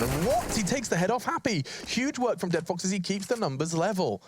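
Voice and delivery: excited. Real, not acted. Caster hype on clutch kill.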